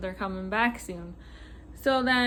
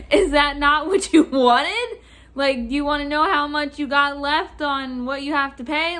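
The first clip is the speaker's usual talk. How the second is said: loud, raised, incredulous questioning; exasperated questioning; 4 semitones higher, 7 dB louder than usual. Retold or imitated. Clerk asking the customer what he wanted, exasperated. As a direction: act out a clerk at a loss, pushing the words.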